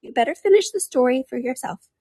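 high, sulky little creature voice; put-out dormouse, pitched high. Acted. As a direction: sulk in a small, high voice. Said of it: "sulkily remarked".

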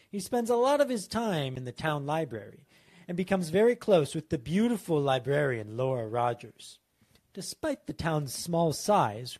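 measured story-synopsis reading; pitch read aloud. Acted. Writer reading a pitch to co-hosts, measured narration. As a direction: read it out like a film synopsis, pausing for drama. Reads out a written film pitch.

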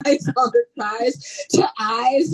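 high, incredulous question through laughter; high-pitched mock shock, laughing and drawing out the end; laughing disbelief. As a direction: retell your disbelief, cracking up as you ask. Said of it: "and I was like".

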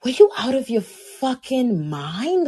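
mock self-scolding. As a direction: drag it out like telling off a friend. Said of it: "I'm like".

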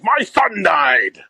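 yelling back. Retold or imitated. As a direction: bark it back at the heckler slowly. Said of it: "he just yells back at the guy".

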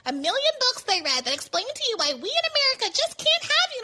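sarcastic high-pitched liberal impression; mock liberal voice. Mocking liberal reasons against Bernie.

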